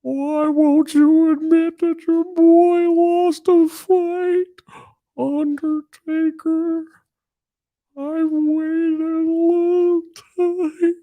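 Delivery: high, slow mournful cry; Paul Bearer. Acted. Streamer mimicking fans begging him to admit the loss, high wailing cry. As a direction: draw it out in a high mock wail. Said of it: "coming with these Paul Bearer cries".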